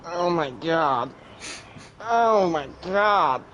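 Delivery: very slow, drawn-out groan; acted pained groan. Dreamer lying smashed on the ground, slow pained groan. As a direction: groan it out as if flattened.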